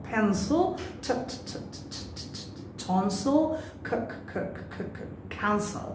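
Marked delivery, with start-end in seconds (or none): 1.0-2.4 soft, unvoiced "t, t, t" with a puff of air; whispered "t" bursts. Shown as a demonstration. Teacher demonstrating the breathy burst in "t" for students. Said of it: "we have to pronounce this one". 3.9-5.9 soft, unvoiced "c, c" bursts leading into "cancel"; whispered "k" puffs. Shown as a demonstration. Teacher demonstrating the breathy "k" before "cancel". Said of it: "we have to pronounce this one".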